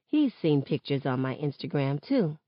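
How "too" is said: plain reading voice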